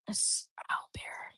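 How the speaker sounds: hushed whisper to the dog; whispered aside. Real, not acted. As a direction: whisper so the stream barely hears.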